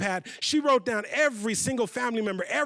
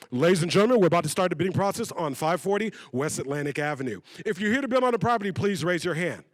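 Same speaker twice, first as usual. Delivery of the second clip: formal auctioneer's announcement, lower pitched; 4 semitones lower, 3 dB louder than usual. Acted. Auctioneer opening the bidding to the room, official announcing voice. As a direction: open the bidding like an auctioneer at a podium. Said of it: "the auctioneer steps up to the podium and goes".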